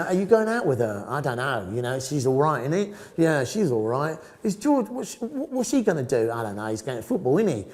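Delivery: quick-fire Cockney banter between mates; chatty London Cockney, pitch jumping about; Cockney accent. Shown as a demonstration. Student gossiping with mates in put-on Cockney. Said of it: "I have a Cockney accent".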